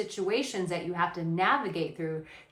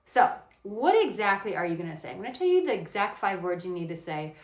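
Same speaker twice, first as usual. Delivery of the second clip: ordinary talk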